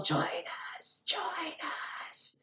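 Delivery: breathy ghost whisper luring her in; ghostly whisper. Retold or imitated. Ghost luring Tanner in retelling.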